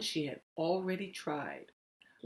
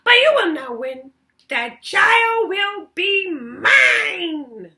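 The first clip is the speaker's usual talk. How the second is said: much higher, much louder, slow shouted goblin; 12 semitones higher, 12 dB louder, slower than usual. Acted. Goblin taunts Lily with a deal, high slow shout. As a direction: bellow it like a gleeful goblin. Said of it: "said the goblin".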